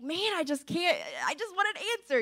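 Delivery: frustrated pleading. Retold or imitated. Woman retelling her plea to God for answers, frustrated.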